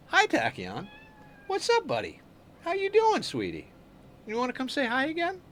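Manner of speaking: high cooing pet voice. Real, not acted. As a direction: coo way up high at the kitty. Streamer greets the cat.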